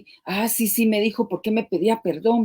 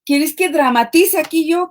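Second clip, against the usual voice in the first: mock husband, pitched higher; teasing husband; 7 semitones higher than usual. Quoting her husband Mario, a playful mock offer. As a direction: tease like her husband offering to fake tears. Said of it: "Me decía".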